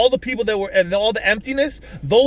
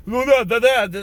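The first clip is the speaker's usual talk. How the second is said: lightly mocking nonsense syllables; mocking people; 3 dB louder than usual. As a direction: imitate someone making fun of people. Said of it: "make fun of people and, like".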